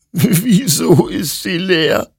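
high, slow, tearful wail; crying, drawn-out lament; sobbing. A narrator's reading. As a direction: sob the question out in despair. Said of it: "schluchzte Hector".

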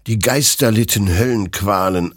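plain reading voice

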